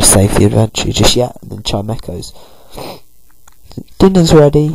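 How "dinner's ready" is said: loud, high dinner call. Acted. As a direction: call it across the house. Calling everyone to dinner.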